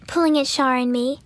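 plain reading voice

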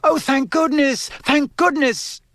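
high-pitched, overwrought mother's cry; relieved cry. A narrator's reading. Mother seeing them safely back, high relieved cry. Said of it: "a cry echoed along the lane".

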